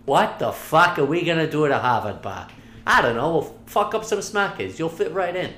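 high mock Boston accent, r's dropped; hammed-up Boston accent retelling the joke. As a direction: replay the rowdy Boston line. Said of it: "talking a Boston accent".

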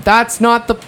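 high, emphatic retold objection; exasperated retort. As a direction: cut off the friend's excuse in exasperation. Retold exasperated answer to friend.

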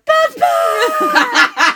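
very high, loud hammed-up cry, laughing; mock wail through laughter. Mocking the movie's grieving girl: laughing hammed-up shout. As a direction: ham up the grief while cracking up. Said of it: "Just all laughing at her".

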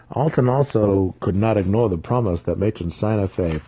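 plain reading voice

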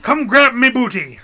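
high, loud pirate shout. Acted. Co-host chiming in on the pirate message, loud pirate shout. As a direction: holler it as a hammy pirate.

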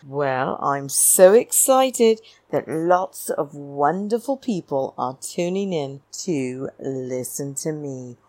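ordinary talk

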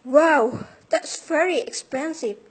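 loud, high shocked exclamation; surprised. Acted. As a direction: gasp at the price out loud. Reacting to high price.

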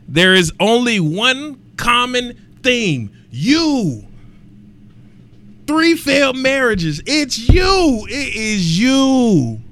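high, loud, slow accusing build to a shout; preacher-style callout of Halle Berry, climbing to a shout; dramatic rant. Real, not acted. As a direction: hammer home the blame after a dramatic pause. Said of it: "I have to take a dramatic pause there".

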